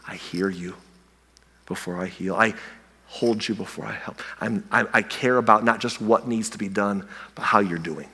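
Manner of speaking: soft and low, gently reassuring; God's tender voice. Acted. God speaking to a hurting person, soft tender reassurance. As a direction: lean in and comfort them quietly.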